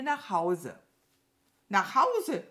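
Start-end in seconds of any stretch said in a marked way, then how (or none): 1.7-2.4 hoch und laut nachfragen; entrüstetes Echo der Krähe; empörter Ausruf. A narrator's reading. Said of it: "rief die Krähe". (laut rufend, ungläubig)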